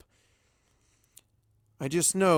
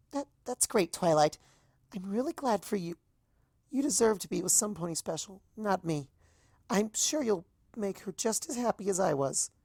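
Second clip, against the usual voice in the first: forced brave Azalea; 7 semitones higher than usual. A narrator's reading. Azalea wishes Twilight well, higher and falsely cheerful. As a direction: smile through the hurt.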